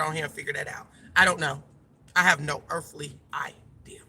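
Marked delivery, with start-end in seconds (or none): 2.1-4.0 unvoiced whisper, mock clueless; whispered aside. Real, not acted. Admitting to viewers she has no clue, in a whisper. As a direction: breathe it out as a confiding aside.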